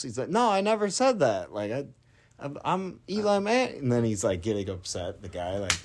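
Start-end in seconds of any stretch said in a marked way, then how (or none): 0.3-3.7 retold higher-pitched denial; defensive protest. Manning correcting the annoyed fan, defensive. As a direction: plead the mistaken identity, pitched up.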